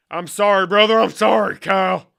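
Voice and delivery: high, loud holler of apology; hammed-up loud pleading; mock apology yell. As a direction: shout the apology over the top.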